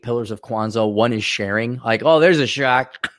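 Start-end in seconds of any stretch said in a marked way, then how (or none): 2.0-3.1 loud, drawn-out mock surprise; sarcastic shock. Host scoffing at one pillar being sharing, sarcastic shock. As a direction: stretch it out loudly with sarcasm.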